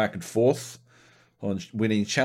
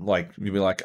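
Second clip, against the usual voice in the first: usual speaking voice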